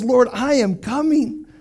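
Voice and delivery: a calm, decided vow, drawn out; slow resolve. Retold or imitated. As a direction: say each word slowly and firmly. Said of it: "David says".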